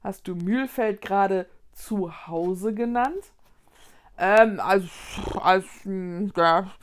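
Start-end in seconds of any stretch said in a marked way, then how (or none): 4.2-6.7 halting, flustered stammer; embarrassed stammer. Acted. Girl caught out by her friend, stammering awkwardly. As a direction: stumble over the words, caught out. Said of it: "stammelte Vicky verlegen".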